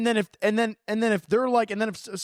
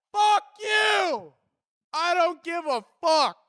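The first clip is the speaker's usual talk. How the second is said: shouted curse; 7 semitones higher, slower than usual. Real, not acted. Ranting at critic.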